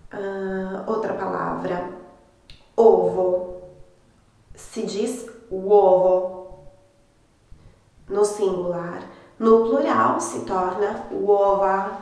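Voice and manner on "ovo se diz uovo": "uovo" drawn out slowly; slow model word. Shown as a demonstration. Teacher slowly models the Italian word for students. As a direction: stretch out "uovo" for the learners. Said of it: "teacher models the Italian word".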